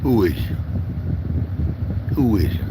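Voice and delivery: loud "Who is you?" with a falling line; loud challenge. Real, not acted. Confronting the man in the video, loud. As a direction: confront him loudly.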